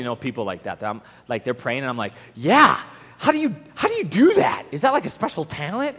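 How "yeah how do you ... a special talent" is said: high, hammed-up amazement; mock awe. Churchgoer marvels at a professional pray-er, mock awe. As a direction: gush like you're dazzled by them.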